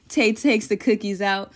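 usual speaking voice

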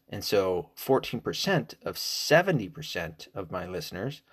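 ordinary talk, nothing marked

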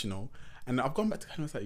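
ordinary delivery

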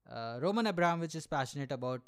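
usual speaking voice

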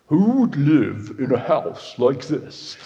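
slow, lightly voiced Lloyd Grossman catchphrase; a hint of the funny TV presenter, drawn out. Preacher mimicking Lloyd Grossman's catchphrase, slow mock presenter voice. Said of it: "He spoke in that very funny voice".

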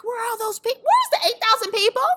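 high, acted-out incredulous demand; exasperated disbelief. Organizer looking for the people who promised to come, exasperated. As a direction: demand to know where everyone went. Acting out panic over no sales.

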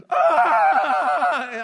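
loud, high mock wail; ugly cry. Shown as a demonstration. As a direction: bawl in a hammed-up, uncontrolled sob. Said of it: "But then what's ugly cry?".

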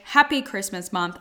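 usual speaking voice

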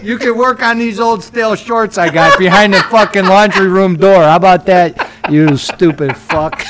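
loud, high, laughing rant; mock furious boss. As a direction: bark orders like a tyrant leader. Playing bossy cult leader.

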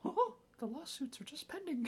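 high squeaky mouse voice, laughing; Mickey Mouse voice. Retold or imitated. Joking about Disney lawsuits, a laughing Mickey squeak. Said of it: "the Mickey voice".

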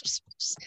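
whispered psst psst; soft cat-calling hiss; kitty-calling psst. Shown as a demonstration. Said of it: "go up to and go Ps".

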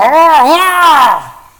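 high loud fake pained cry; fake flop cry. Big player flopping on the court, fake cry of pain. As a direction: wail like a player faking a foul. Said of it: "talking about".

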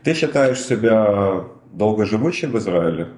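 usual speaking voice

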